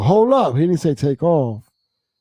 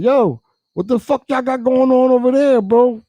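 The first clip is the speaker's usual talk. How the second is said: higher, louder call across the road; 10 semitones higher, 5 dB louder than usual. Retold or imitated. Shouting to a crew across the highway, loud call. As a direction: holler over to the other crew.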